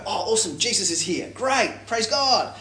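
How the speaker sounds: mock cheerful welcome. Demons pretending to welcome Jesus, mock cheerful. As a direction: gush it like you don't mean it.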